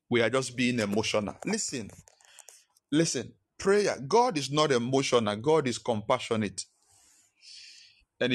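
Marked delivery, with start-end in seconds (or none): none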